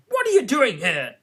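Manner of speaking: high, loud demand; Queen interrogates the gardeners. A narrator's reading. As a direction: demand it sharply.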